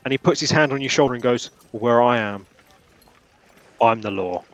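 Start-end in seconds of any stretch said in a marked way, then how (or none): 1.7-4.4 lower, menacing lawman delivery; stern lawman. Acted. Guard looming over the player, delivering the line lower. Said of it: "He actually said that a lot better than I did".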